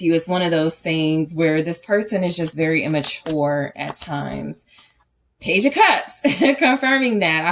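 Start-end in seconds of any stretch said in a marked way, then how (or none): 5.4-7.4 high, loud burst breaking into a laugh; amused exclamation. Real, not acted. Reader to viewer as a card confirms the reading, amused exclamation.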